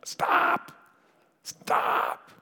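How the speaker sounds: drawn-out shouted command to a dog; re-enacted yell. Owner yelling at his runaway dog to halt, long shout. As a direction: holler it after the runaway. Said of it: "'cause I'm yelling".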